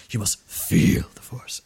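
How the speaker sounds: put-on slow, whispered old master leaning hard on feel; Yoda. Fan imitating how the puppet master says the line, slow whisper. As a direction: hush it out slowly and lean on "feel". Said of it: "the way he says the line".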